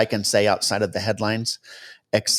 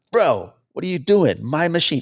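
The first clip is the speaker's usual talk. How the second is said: higher, louder retold yell; mock yelling; 7 semitones higher, 4 dB louder than usual. Other pilot takes the controls back, mock yelling at his copilot. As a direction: imitate an alarmed pilot yelling. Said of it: "other pilot starts yelling at him".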